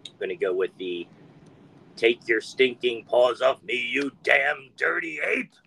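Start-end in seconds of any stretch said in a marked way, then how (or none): 1.9-5.5 slow, high, forceful movie yell; Charlton Heston. Retold or imitated. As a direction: growl it out slowly, like the ape-planet astronaut. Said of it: "Spoken by none other than Charlton Heston".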